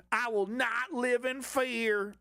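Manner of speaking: high, slow, pompous mock patriot; mock slogan voice. Mocking right-wing posters in a high, slow pompous voice.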